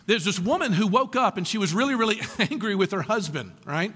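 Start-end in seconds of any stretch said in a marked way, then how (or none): none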